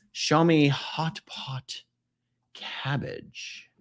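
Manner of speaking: ordinary delivery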